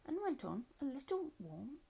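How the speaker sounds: ordinary delivery, nothing marked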